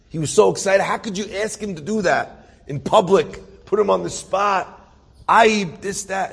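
acted-out scandalized protest; outraged scolding. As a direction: pile on reproaches like an angry crowd. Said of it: "They all wanna kill him".

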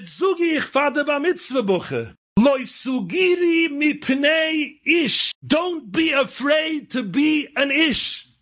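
slow, high oratory imitating an old-time rabbi; imitated Yiddish sermon, ringing exhortation; grandfather's drasha. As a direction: proclaim it to the bar mitzvah boy, high and drawn out. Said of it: "incorporated the whole story into his drasha".

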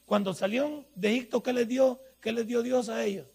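normal talking voice

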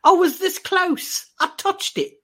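very high, slow put-on voice; hammed-up movie quote. Quoting a comedy film to co-host, high hammed-up voice. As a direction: squeeze the quote out high and drawn out.

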